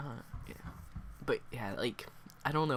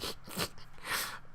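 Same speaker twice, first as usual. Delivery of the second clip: breathy, voiceless burst of laughter; helpless laugh that breaks up the reading; real laugh; 5 dB quieter than usual. Real, not acted. Reader cracking up at a sad coming-out line, real laughter.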